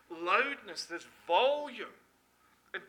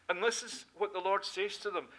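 ordinary delivery

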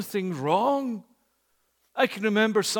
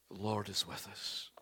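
ordinary delivery

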